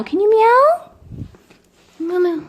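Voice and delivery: high pleading pet voice. Real, not acted. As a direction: coax the cat to meow. Asking cat to meow.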